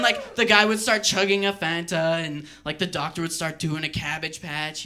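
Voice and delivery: normal talking voice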